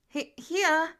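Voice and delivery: startled call. Acted. Peter startled when his name is called, flustered. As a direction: blurt it out caught off guard.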